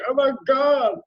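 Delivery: high, loud overwhelmed cry; exasperated exclamation. Retold or imitated. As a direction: throw up your hands, loud and high. Exasperated at renewing subscriptions.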